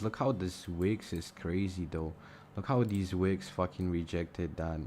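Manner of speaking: ordinary delivery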